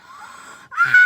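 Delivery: high, loud scream breaking into a laugh; terrified scream. Retold or imitated. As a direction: shriek like you just got caught. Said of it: "start screaming so fucking loud".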